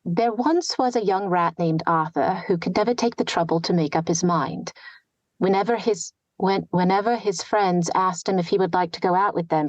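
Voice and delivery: a light New Standard English accent on a read story; reading in target accent. Shown as a demonstration. Reads passage in target accent.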